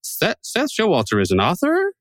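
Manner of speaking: "author" lifted high, as if a question mark followed; read as a question. Acted. Host mimicking a blind cue-card reader, statement read as question. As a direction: turn "is an author" into a puzzled question, pitch climbing. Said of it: "Did he say that as a question?".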